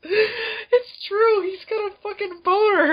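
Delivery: very high squeal through laughter; helpless laughter. Real, not acted. Co-host cracking up over the monster's groin, high squealing laughter. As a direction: crack up, voice shooting way up.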